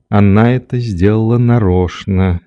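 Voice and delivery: ordinary delivery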